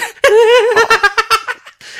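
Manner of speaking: high, loud burst of laughing; real high laughter. Real, not acted. Laughing at phone anecdote. (又高又响地大笑)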